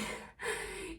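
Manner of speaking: high, airy giggle; real breathy high laugh; amused giggle. Real, not acted. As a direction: giggle mid-story. Laughs while telling mum story.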